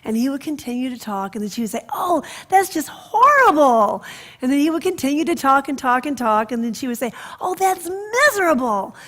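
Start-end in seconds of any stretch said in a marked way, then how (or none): 1.9-4.0 high, loud, slow cooing sympathy; sympathetic grandmother. Retold or imitated. Grandmother soothing her grandson, high sympathetic coo. 7.4-9.0 high, loud pitying sigh of a grandmother; sympathetic grandmother. Retold or imitated. Grandmother comforting the boy after school, high pitying voice.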